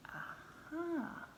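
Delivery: whispered secret aside; sly whisper. A narrator's reading. Storyteller to children about the trick, whispering.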